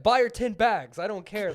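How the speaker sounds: mock fed-up customer; fed-up outburst. Retold fed-up man slamming a dollar.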